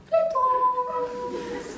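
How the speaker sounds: high sing-song call; calling the glitons. Shown as a demonstration. Teacher calling tiny dragons from the trees in a high sing-song. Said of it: "you just go".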